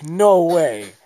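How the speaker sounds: hammed-up battle protest; mock refusal. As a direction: shout it in playful defiance.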